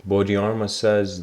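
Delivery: ordinary talk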